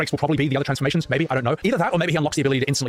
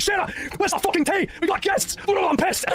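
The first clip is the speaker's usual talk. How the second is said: much higher mock rage at Shira; much higher hammed-up rant; raging Sid; slower than usual. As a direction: ham up a furious hot-headed pilot. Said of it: "OG FF seven Sid ... explodes into a rage".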